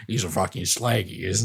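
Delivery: low, soft Aussie insult in imitation; a light Australian Hugo Weaving put-on; Australian accent. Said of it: "you can't say that, Hugo Weaving".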